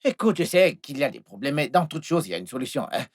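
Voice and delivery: low, soft, placating voice; calm an upset sweetheart gently, keeping it low; hushed coaxing reassurance; soothing reassurance. Acted. Reassures lover, offers car keys.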